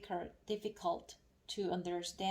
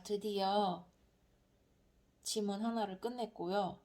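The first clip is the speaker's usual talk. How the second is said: usual speaking voice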